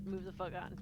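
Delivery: ordinary talk, nothing marked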